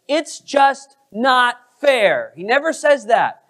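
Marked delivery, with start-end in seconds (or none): 0.1-2.3 high, slow, drawn-out complaint; imitating an aggrieved protest; mock protest. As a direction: complain word by word, pitched up. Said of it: "he doesn't say".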